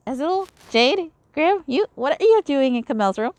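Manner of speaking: high, startled outburst; high surprised cry. Acted. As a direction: blurt it out, caught off guard. Startled character finds visitors.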